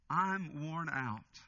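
low, slow, spent murmur; weary and worn out. Acted. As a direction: let each line sink, exhausted. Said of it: "He's saying".

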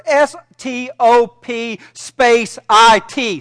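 slow, letter-by-letter spelling; spelled out slowly. Acted. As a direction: spell each letter out like dictation. Therapist spells out the words.

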